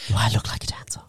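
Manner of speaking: whispering to herself; whispered aside. Real, not acted.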